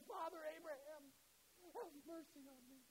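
lightly voiced choking, pleading rich man, high and slow; a hint of a man choking in flames, begging; choked plea. Preacher voices the rich man begging Abraham, lightly choked plea. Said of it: "as he choked out the word".

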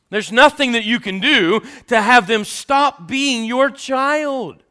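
loud, high pulpit preaching; rising loud sermon delivery; preaching at full force. Acted. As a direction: preach it out to the whole congregation.

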